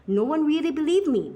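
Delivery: ordinary delivery, nothing marked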